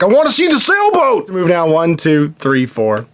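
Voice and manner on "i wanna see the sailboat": high, lightly voiced dreamy dude; mock the daydreaming guy, pitched up; dazed moviegoer. Mocking the Mallrats guy who just stands there, high and dreamy. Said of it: "He's like".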